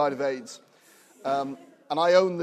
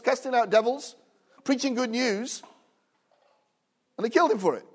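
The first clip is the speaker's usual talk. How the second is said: higher, louder, driving sermon delivery; full-force preaching; 6 semitones higher, 4 dB louder than usual. Acted. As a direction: preach it out with building fervour.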